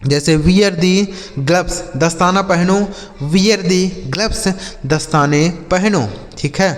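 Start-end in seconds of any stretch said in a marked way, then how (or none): 0.4-1.8 slow, careful English question; slow model reading. Shown as a demonstration. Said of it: "slow model phrase". 3.3-4.5 slow, careful English question; slow model reading. Shown as a demonstration. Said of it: "slow model phrase repeated".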